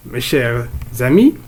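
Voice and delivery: ordinary delivery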